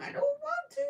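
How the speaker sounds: high squeaky whine of reluctance; whiny. Real, not acted. Whining to the stream about unravelling the work.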